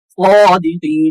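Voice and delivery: slow chanted सादिकीन; long vowel on saad. Shown as a demonstration. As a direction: stretch saa in सादिकीन, then the final ee. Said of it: "साद के ऊपर खड़ी हरकत".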